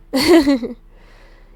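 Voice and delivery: a big loud burst of laughter. Acted. Loud laugh after she leaves.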